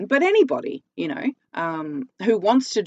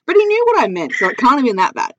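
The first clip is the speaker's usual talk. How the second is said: high, louder laughing retort; amused protest; 8 semitones higher, 7 dB louder than usual. Real, not acted. Laughing self-defence.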